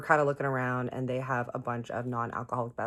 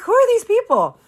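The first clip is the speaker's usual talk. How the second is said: very high, loud incredulous question; acted shriek of bafflement; baffled outburst; 14 dB louder than usual. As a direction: blurt it out in high-pitched disbelief. Baffled by signatures on guitar.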